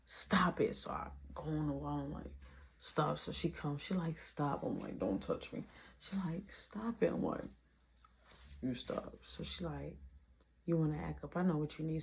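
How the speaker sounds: low, very soft murmur; hushed retelling. Retold or imitated. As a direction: drop way down, nearly whispering the intimate exchange. Retold hushed intimate tussle.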